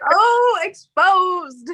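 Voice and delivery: high, loud teasing crow; mock call-out. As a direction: crow it like catching a friend out. Teases him for being caught out.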